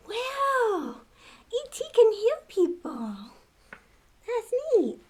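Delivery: put-on high, eager kid's wonder; amazed. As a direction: gush over the healed cut. Child amazed by healing.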